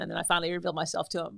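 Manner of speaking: ordinary talk, nothing marked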